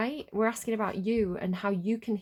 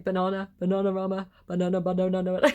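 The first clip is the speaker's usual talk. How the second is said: goofy sing-song banana, getting louder; mock-silly banana chant, swelling louder; stupid silly way; 5 dB louder, faster than usual. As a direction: say banana as daftly as you can. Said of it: "I'm just saying banana in a stupid way".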